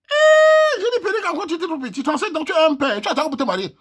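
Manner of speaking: squealing high and loud at the suitor; mocking outcry, pitch way up; high-pitched jeer. Real, not acted. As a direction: shriek the teasing at him. Mocking the 37-year-old bachelor.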